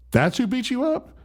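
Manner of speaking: high-pitched mock disbelief, rising question; incredulous. Mom seeing who beat her son, incredulous. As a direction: imitate a mom who can't believe it. Mom's disbelief at the small boy.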